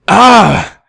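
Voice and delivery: sharp high frustrated yell; loud fed-up outburst; exasperated cry. Acted. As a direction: snap with frustration. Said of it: "I felt something in my head snapping".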